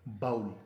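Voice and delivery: बाउल rhyming with owl; common mispronunciation. Shown as a demonstration. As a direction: open "ba-ul" in बाउल. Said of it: "गलत तौर पे बाउल कह के". (बाउल, "आउ" की आवाज़ के साथ)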